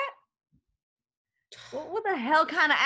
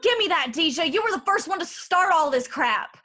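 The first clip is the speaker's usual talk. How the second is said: put-on loud, raised-pitch drunken outburst; angry accusation; 3 semitones higher, 5 dB louder than usual. Drunk woman blaming her friend for the fight, angry. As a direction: lash out at your friend, loudly.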